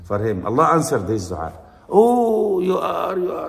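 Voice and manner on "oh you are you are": high, drawn-out awed "oh"; amazed crowd. Retold or imitated. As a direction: act out the crowd marvelling, pitched high.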